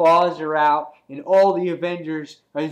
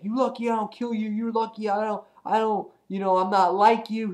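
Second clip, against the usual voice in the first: lightly voiced, higher-pitched indignant hero; a slightly raised voice for a scolding hero; Spider-Man; 6 semitones higher than usual. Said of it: "And Spider-Man goes on. He's like".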